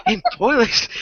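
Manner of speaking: normal talking voice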